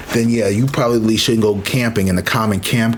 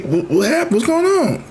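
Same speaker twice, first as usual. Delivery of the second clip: surprised questions; 13 semitones higher than usual. Retold or imitated. Arriving back at camp, asking her husband, climbing startled pitch. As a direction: pitch jumping high, startled and asking fast.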